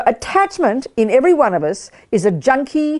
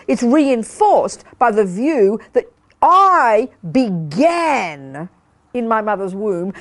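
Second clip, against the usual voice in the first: ordinary delivery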